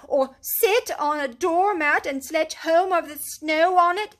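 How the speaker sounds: high, loud, sarcastic scolding; fed-up little animal ranting; exasperated Mole. A narrator's reading. Mole rants at Rat over the doormat, exasperated. Mole's voice held.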